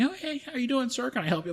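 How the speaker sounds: high, sugary customer-service voice; high chirpy mock-friendly clerk; mock store clerk. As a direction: imitate cheery shop staff greeting. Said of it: "are asking".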